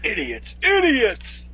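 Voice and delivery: high, loud, drawn-out insult; hammed-up exasperated artist's cry; mock outrage. Co-host quoting the beatnik painter scorning people, mock outrage.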